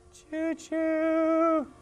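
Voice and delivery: very high whistle-like choo-choo; playful train whistle; high train whistle imitation. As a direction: toot it like a toy train.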